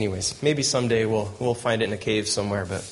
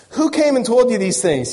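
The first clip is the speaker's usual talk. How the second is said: demanding king; 10 semitones higher, 6 dB louder than usual. Acted. King demands who warned him.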